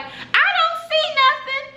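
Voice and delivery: put-on very high-pitched child voice; little boy. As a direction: mimic a small boy piping up. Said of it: "the little boy is like".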